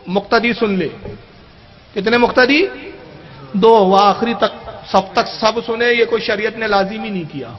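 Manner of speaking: ordinary talk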